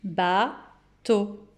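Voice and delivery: "Bateau" slowly as ba, pause, teau; detached teacher's "Ba-teau"; syllable by syllable. Shown as a demonstration. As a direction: break "Bateau" in two with a clear gap. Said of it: "où vous entendez le son O".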